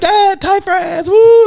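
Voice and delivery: very high fan cheer, ending in a loud woo; drawn-out falsetto whoop of a cheering crowd; imitated crowd cheer. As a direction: re-enact fans cheering their player. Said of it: "and we'd be like".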